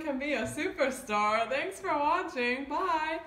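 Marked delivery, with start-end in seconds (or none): none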